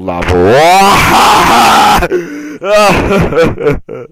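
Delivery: loud hearty burst of laughter. Real, not acted. Loud laugh after signing off.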